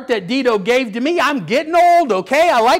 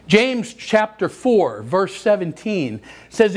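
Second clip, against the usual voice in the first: normal talking voice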